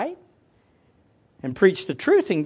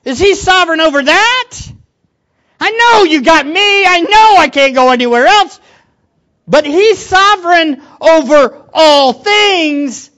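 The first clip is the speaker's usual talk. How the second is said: loud, higher, pleading inner voice; acting out his own doubts; 6 semitones higher, 9 dB louder than usual. Pastor voicing his doubts to Jesus, loud and high. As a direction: argue with God out loud. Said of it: "I think like this".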